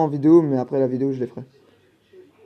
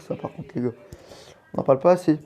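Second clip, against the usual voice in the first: ordinary talk, nothing marked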